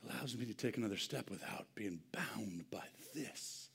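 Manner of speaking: hushed, breathy whisper of relief; whispered preacher aside. Real, not acted. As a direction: drop to a whisper.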